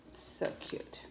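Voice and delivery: soft, unvoiced cooing over something little; tiny whispered aside; whispered admiration. Real, not acted. Admiring her little purchase.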